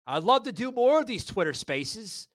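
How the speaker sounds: normal talking voice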